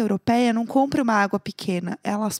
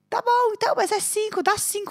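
higher, mock drunk insistence; drunk friend; 9 semitones higher than usual. Drunk Neco haggling at the bar, high mock drunk voice. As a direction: play the stubborn drunk friend demanding five.

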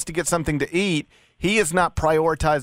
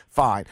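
"fine" set apart after a pause, low and falling; stress on fine; 7 semitones lower than usual. Real, not acted. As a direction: drop "fine" low as faint praise. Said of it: "I emphasized the word fan".

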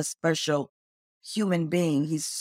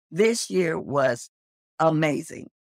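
ordinary talk, nothing marked

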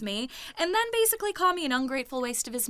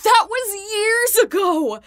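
tearful, pitched-up protest; crying; 9 semitones higher, 9 dB louder, slower than usual. Acted. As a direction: voice breaking into tears, high and slow. Arguing with dad, near tears.

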